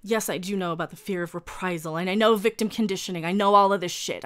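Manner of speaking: normal talking voice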